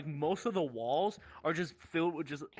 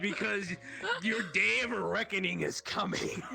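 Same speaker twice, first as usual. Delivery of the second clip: mock villain threat; 6 semitones higher than usual.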